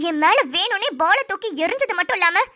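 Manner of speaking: normal talking voice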